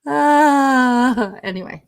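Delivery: normal talking voice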